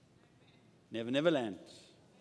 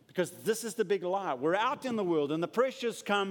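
usual speaking voice

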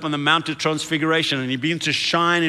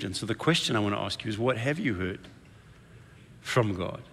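soft intimate question; 5 semitones lower, 8 dB quieter than usual. Real, not acted. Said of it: "quiet closing question to congregation".

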